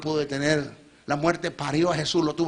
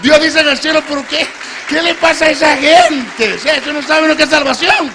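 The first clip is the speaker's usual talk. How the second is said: high, loud impassioned preaching cry; preaching at full force; 10 semitones higher, 14 dB louder than usual. Acted. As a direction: shout the truth home to the crowd. Predicación a pleno volumen. (predicación a gritos, apasionada)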